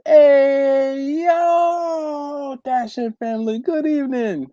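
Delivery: very high, long-held 'heeey, yooo'; drawn-out greeting. Acted. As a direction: sing out a big hearty hello, stretching the first words. Reads viewer's drawn-out greeting aloud.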